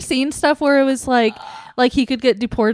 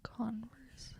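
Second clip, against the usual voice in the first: whispering; 24 dB quieter than usual. Real, not acted. Puzzling over the clue word.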